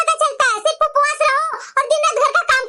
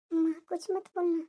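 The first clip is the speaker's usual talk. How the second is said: softer, hurried warning meant only for her mother; hushed aside; 7 semitones lower, 13 dB quieter than usual. Acted. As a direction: hush her mother before she speaks. Daughter warns mother secretly aside. (माँ को चुपके से धीमी आवाज़ में चेतावनी)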